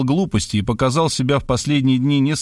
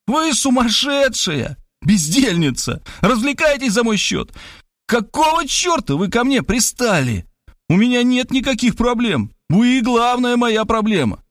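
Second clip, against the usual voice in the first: yelling; 9 semitones higher than usual. Acted. Writer screaming insults at the meddling woman. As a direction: scream insults in a voice not your own. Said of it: "я опять заорал".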